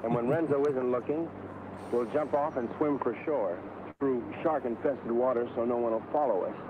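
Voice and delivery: ordinary talk, nothing marked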